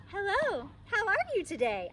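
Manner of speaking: high, nervous innocent greeting; girl caught in the crib greets her dad, high and nervous; kinda nervous. Acted. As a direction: act casual while caught red-handed. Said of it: "I said, kinda nervous".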